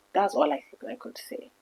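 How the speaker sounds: barely getting the words out through tears; choked up, voice giving way; holding back tears. Real, not acted. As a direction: voice fading to a near-breathless hush. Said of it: "I'm trying not to cry".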